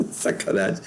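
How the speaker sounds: breathy whispered joke; whispered aside. Real, not acted. Joking aside after the laugh. (sussurro brincalhão)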